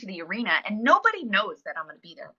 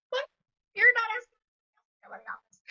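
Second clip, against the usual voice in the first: high, incredulous, voice jumps way up; can't believe they mean you, pitch leaping; a squeak of surprised disbelief; stunned disbelief. Retold or imitated. Disbelief at being called in.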